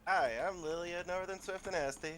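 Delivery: high put-on character voice, giving out; Lily. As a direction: introduce yourself in a high, strained halfling voice. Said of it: "I can't do that voice".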